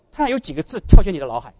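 normal talking voice

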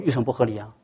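losing the argument; grudging mumble. Retold or imitated. Second weaker retort.

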